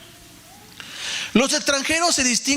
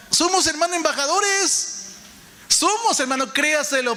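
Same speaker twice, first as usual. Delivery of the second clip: proclamación exaltada; 6 semitones higher than usual. Real, not acted. Predicación exaltada al cierre.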